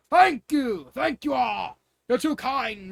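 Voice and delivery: loud, high hearty thanks; jovial king. Acted. King thanking cheering crowd, hearty and loud. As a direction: soak up the applause.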